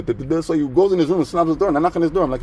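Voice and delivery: usual speaking voice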